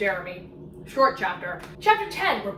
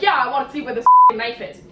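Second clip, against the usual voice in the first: fast and louder, exasperated; fed up; 8 dB louder, faster than usual. Retold or imitated. Lowen, fed up, demanding to find the knife, fast and loud. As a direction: rattle it off, fed up and swearing. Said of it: "And she's like".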